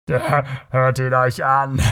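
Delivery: slow mocking call with a laugh; spöttisch. A narrator's reading. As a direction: jeer at him for the crowd. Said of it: "rief er spöttisch". (gedehnter, höhnischer Ausruf mit Lachen)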